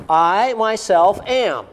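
"I myself am" spoken very slowly, each word weighted; emphatic stress. Shown as a demonstration. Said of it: "It's for emphasis".

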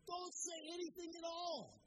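a king losing his temper at his prophet; frustrated king. Acted. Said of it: "Balak the king is getting so frustrated".

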